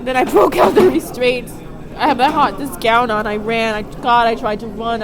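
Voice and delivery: real upset, voice rising loud as the memory returns; real agitation. Real, not acted. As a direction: spill it out, reliving the escape. Reliving escape from restraints.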